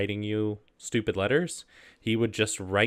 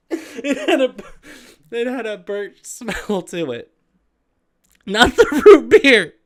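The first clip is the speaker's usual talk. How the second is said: reading through helpless giggles, much higher; can barely get the words out for laughing; high, squeaky voice cracking with laughter; 14 semitones higher, 11 dB louder than usual. Real, not acted. Breaks down laughing while reading.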